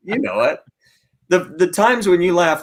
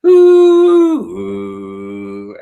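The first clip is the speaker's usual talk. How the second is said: foghorn sound; 7 dB louder than usual. Retold or imitated. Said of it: "You're making a foghorn sound".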